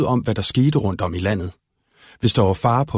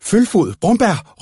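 higher, louder calling; shouting names; 8 semitones higher, 3 dB louder, much slower than usual. Acted. Slåen shouts after the running children. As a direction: call them back as you run. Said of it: "råbte han".

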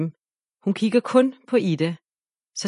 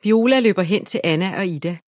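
usual speaking voice

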